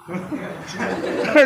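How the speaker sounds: airy little mock giggle; breathless giggle. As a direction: giggle like a delighted kid. Child happy, laughing. (खिलखिलाती हँसी)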